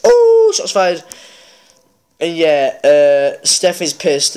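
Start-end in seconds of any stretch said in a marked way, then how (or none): 0.0-1.0 excited. Real, not acted. Reacting to on-air insult.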